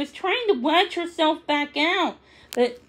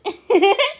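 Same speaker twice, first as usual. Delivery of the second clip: kitten giggle; 7 semitones higher, 5 dB louder than usual. Acted.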